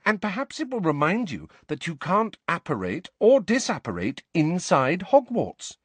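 high, emphatic, exasperated lecture. A narrator's reading. Hermione explaining Hogwarts rules to Harry, exasperated. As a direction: spell it out in exasperation, leaning on key words. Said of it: "sounding thoroughly exasperated".

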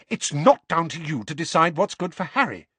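high, loud and cutting; sharp angry mother. A narrator's reading. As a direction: snap back at him. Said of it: "said Missus Weasley sharply".

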